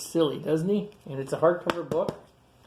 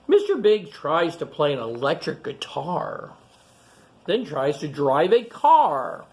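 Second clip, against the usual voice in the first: clear, lively picture-book reading voice; reading to children; 3 semitones higher, 5 dB louder than usual. Acted. Reading a picture book aloud to young kids, storytelling. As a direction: read it out to the kids gathered round.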